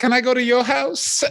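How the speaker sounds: high, sweet mock proposition; sing-song come-on; high teasing come-on; imitating a street worker. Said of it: "my personal favorite".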